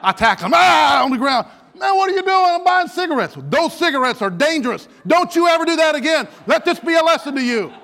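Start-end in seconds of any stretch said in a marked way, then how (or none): 0.5-1.0 loud high "ah" on impact; tackle yell. Acted. Officer tackling a suspected shoplifter, loud yell. 1.8-7.7 loud, high-pitched hollered telling-off; mock angry scolding. Officer to tackled cigarette buyer, shouted scolding.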